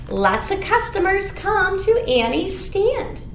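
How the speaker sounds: usual speaking voice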